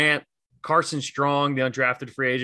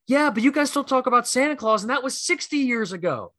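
imitated passionate comeback, very high pitch; 11 semitones higher than usual. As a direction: fire back like an outraged Philly fan. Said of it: "let his Philly passion jump out".

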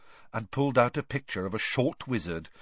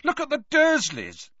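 much higher, louder, angry outburst; furious; 5 dB louder than usual. A narrator's reading. Harry offering his own relatives as proof, furious. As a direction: throw it out hotly, voice leaping up. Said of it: "Harry said furiously".

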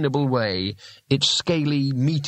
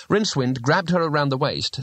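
ordinary delivery

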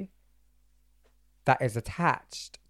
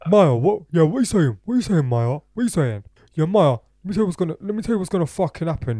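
put-on high, loud, pushy drunk voice; mock drunk lad; 4 semitones higher, 6 dB louder, faster than usual. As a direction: play the drunk friend bragging on the phone. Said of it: "I didn't say it like that".

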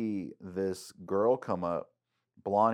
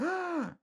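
mock gasp. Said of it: "it's like".

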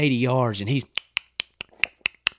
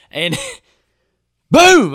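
mock gunshot boom; 12 dB louder than usual. Imitating the gunshot.

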